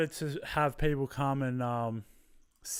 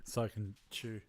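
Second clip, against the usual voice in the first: distant and quiet, off mic; away from the mic; 3 semitones lower, 13 dB quieter than usual. Real, not acted. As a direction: lean away from the microphone. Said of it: "Moving away from the mic".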